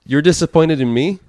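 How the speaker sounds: mock disbelief. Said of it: "I'm like".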